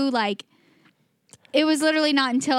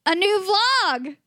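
higher, slow dramatic announcement; dramatic reveal; 7 semitones higher, much slower than usual. Acted. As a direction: draw it out like a big reveal.

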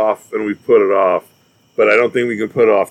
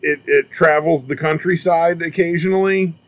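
mock grand; 8 semitones higher than usual. Explains big train, mock grand.

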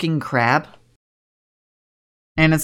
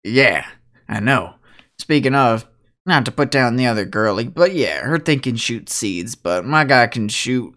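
put-on lower, easygoing older guy; laid-back cowboy; 5 semitones lower than usual. Mitchell greeting the party, lower and laid-back. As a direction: drop it down, relaxed and modest. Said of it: "He goes".

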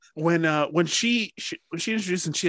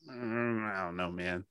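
quiet grumble; 4 semitones lower, 6 dB quieter than usual. Retold or imitated. Muttered complaint about accent.

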